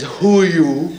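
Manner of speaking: a lightly done imitation of how God talks; God's way of talking. God greeting a politician at heaven's gate, lightly imitated. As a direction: give a hint of God's odd way of speaking. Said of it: "'Cause he talks like that".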